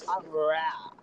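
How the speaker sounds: "real" mangled into an open "raa"; dopey pronunciation. Retold or imitated. Repeating the impression, "real" mockingly said as "raa". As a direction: let "real" sag into a dumb "ra-a".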